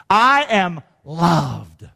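very slow, drawn-out declaration; deliberate affirmation. Shown as a demonstration. Preacher restating the point to the congregation, slow deliberate declaration. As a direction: stretch each word right out.

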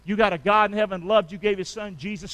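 usual speaking voice

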